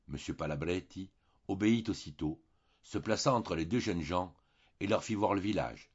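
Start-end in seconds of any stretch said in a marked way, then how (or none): none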